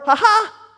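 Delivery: high, loud sarcastic cackle; mock gloating laugh. Preacher mocks David with a gloating laugh. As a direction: laugh like a villain who got away with it. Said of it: "mock gloat".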